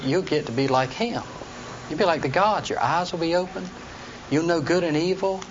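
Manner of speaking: a hint of a sly serpent sweet-talking Eve; tempting serpent. Teacher voices serpent to Eve.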